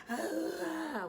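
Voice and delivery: a high, cracking cry of distress; upset wail. Retold or imitated. Mom breaking down on the phone to her boyfriend.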